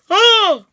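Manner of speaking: sharp, high, loud gasp. Acted. Vlogger breaking off with a loud high gasp. Startled sound in intro.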